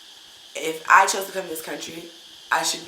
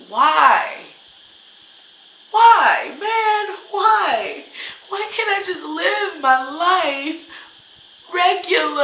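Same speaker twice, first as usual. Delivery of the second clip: much higher and louder, a pleading shout of why; slowed, anguished shout ending in a bitter laugh; exasperated outcry; 10 semitones higher, 9 dB louder, much slower than usual. Retold or imitated. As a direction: cry out to nobody, fed up and hurt. Voicing her own frustrated outcry.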